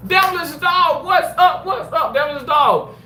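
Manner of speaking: high, loud shout-out; excited greeting. Real, not acted. Streamer greets a viewer arriving in chat, excited. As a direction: call out to a friend who just arrived.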